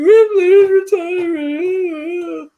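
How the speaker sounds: very high, very slow wailing sob; losing it. Retold or imitated. Telling grandma why he's crying, an imitated high, drawn-out wail. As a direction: wail it out like you're falling apart. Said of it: "I lost my shit".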